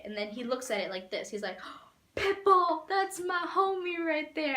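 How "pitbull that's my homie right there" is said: much higher, louder, slow hyped shout. Retold or imitated. Imitating [name] excited over the [name] picture, much higher and louder.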